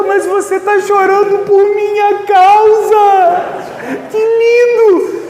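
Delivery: high, slow, doting wife voice; wife moved that her husband cried, high and drawn out; imitating his wife. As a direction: coo it like the charmed wife, slowly. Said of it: "falou desse jeito pra mim".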